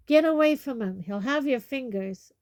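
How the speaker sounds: loud, high urgent shout; shouted warning. A narrator's reading. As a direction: yell them away from danger. Hagrid calls out a warning.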